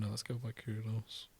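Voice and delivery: ordinary talk, nothing marked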